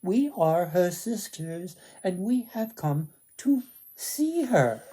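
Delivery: high, falsely sweet sisters' voice; sugary wicked sisters. Acted. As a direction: fake kindness to get past the guard. Wicked sisters feign friendliness.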